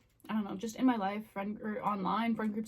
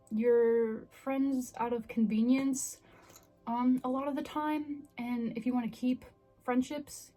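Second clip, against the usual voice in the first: ordinary talk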